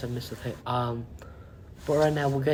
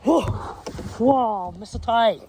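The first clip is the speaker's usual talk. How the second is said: startled whoa; 6 semitones higher, much slower than usual. Acted. Interviewer startled by the fire.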